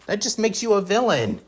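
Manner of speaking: acted boyish protest; earnest protest. A narrator's reading. Boy stands up to bully.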